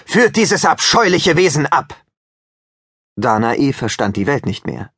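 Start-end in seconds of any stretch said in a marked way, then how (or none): 0.1-2.0 king angrily ordering the guards; angry command. Acted. King orders daughter taken away.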